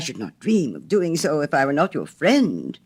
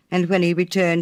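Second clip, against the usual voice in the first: ordinary delivery, nothing marked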